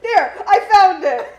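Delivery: loud and high through giggles; can't stop laughing while talking. Real, not acted. Giggling fit while reading fact.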